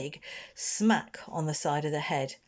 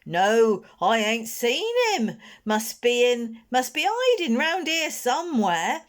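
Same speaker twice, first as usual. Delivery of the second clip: loud, higher rough lad's voice; calling back; 7 semitones higher, 6 dB louder than usual. Acted. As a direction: yell back while hunting. Boys calling while searching.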